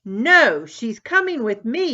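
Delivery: a sister yelling as she pulls her friend away. Acted. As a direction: grab her and yell. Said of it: "yelled Tammy".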